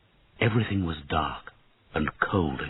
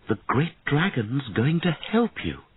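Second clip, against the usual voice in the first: soothing queen; 7 semitones higher than usual. A narrator's reading. Queen soothes her son.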